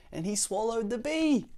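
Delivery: loud, high, rising to the reveal; dramatic storytelling alarm. Acted. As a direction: announce the mishap to young listeners. Story climax, bee swallowed.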